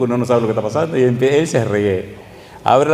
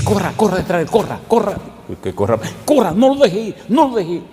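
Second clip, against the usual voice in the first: higher, urgent imitation of the old man; urgent elder's call; 7 semitones higher than usual. Elder urging the new pastor to chase the man, excited and high. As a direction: hurry the pastor along.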